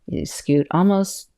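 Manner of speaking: ordinary delivery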